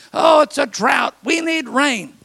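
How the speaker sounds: exaggerated moan; mock whine. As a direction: grumble like a never-happy neighbour. Mock weather complaint.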